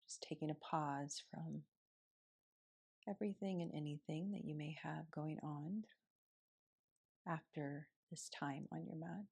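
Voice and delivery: soft, unhurried meditation voice with long pauses; guided meditation. Acted. Yoga teacher guiding students through morning seated meditation, softly.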